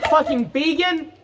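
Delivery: high yell broken by laughter; laughing high yell. Real, not acted.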